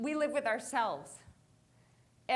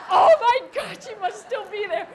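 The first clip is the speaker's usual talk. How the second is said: excited; 7 semitones higher, 16 dB louder than usual. Retold or imitated. Realises rival is close.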